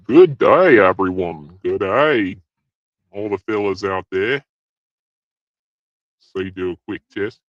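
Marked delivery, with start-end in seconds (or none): none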